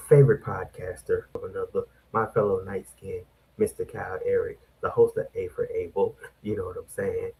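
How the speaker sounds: whispering. Acted. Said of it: "why you whispering like we in the nineteen seventies".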